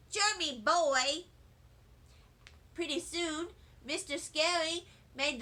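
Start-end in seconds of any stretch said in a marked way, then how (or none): none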